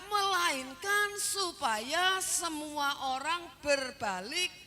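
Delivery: slow, ringing pulpit delivery; emphatic scripture quoting. Acted. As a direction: draw out each word of the verse. Preacher quotes scripture emphatically.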